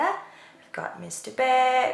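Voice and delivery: usual speaking voice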